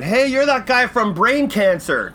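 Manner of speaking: put-on high, slow, loud drunk heckle; drunk dude. Drunk dude recognizing a comedian on the street, loud and slow. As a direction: slur it like a tipsy fan. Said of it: "He was all drunk".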